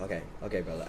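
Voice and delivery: low, soft brush-off; dismissive acceptance. Retold or imitated. Retelling his reply to the stranger, quietly dismissive. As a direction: drop the voice and let it go.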